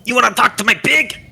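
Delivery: high-pitched halfling voice; touchy halfling. Acted. Halfling challenging a stranger about his pig, high-pitched and touchy. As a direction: challenge them in a high little voice. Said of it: "finally the halfling speaks".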